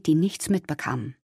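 plain reading voice

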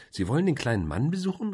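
exclaiming. A narrator's reading. Caroline reacts to the planned visit, exclaiming. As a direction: cry out with big pitch swoops. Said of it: "rief Caroline".

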